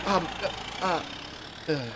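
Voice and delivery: soft, halting false starts; faltering, slow hesitation; hesitant. Acted. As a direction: try to speak and fail. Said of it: "wants to say something as she goes".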